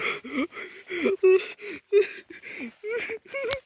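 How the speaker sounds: high put-on villain cackle; evil cackle. Prank laugh in put-on voice.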